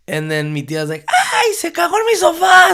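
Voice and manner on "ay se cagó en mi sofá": much higher, louder shriek through laughter; aunt's laughing shriek. Retold or imitated. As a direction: shout it like a scandalized laughing aunt. Aunt shrieks about her couch.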